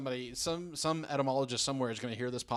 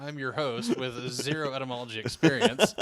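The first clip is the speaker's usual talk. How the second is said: louder, amused announcer welcome; mock show host; 3 semitones higher, 6 dB louder than usual. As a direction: open a joke roundtable like a host.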